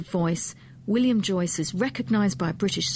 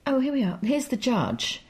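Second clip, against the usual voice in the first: normal talking voice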